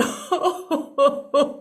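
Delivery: a long, high, wailing half-laugh, half-sob; over-the-top weepy cackle. Acted. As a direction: break into a hammed-up wail. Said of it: "wailing and crying louder than ever".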